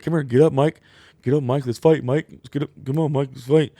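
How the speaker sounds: loud high taunting; mock challenge. Jason challenges Mike to fight, mock taunt. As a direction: call him out to fight. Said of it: "he was like".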